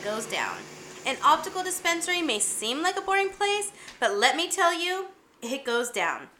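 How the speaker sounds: soft, breathy-free hushed host intro read; show intro. Acted. Podcast-style intro read.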